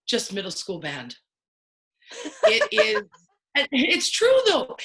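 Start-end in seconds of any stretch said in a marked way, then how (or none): 2.4-4.8 high, loud laughing words. Real, not acted. Laughing at her own answer.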